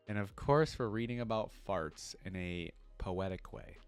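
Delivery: ordinary talk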